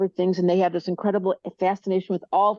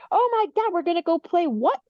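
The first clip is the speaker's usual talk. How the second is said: put-on much higher, gushing like an overexcited little kid; kid-like excitement; 9 semitones higher than usual. Fire people as little kids, over-the-top excited. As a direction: squeal it out like a child promised a treat. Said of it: "They're like little kids".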